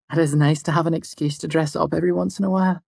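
high, quick feminine voice; pleased young woman. A narrator's reading. Fela enjoys her dress, high and quick. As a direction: chat brightly about dressing up.

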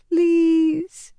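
put-on very high, small girl's plea; a sick little girl begging; young girl pleading. A narrator's reading. Sick girl in the next bed begs Nynaeve, very high and small. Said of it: "a young girl said".

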